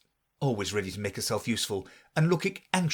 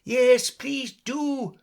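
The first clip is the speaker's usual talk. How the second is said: high, very slow, louder chorus; chorus of creatures; 13 semitones higher, 5 dB louder, much slower than usual. A narrator's reading. The animals begging the mouse back, chorus. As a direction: join the chorus pleading. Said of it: "the others all joined in chorus".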